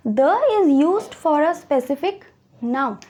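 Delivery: very slow, every word spaced; slow dictation. Shown as a demonstration. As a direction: dictate the rule to the class. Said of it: "teacher dictates the rule".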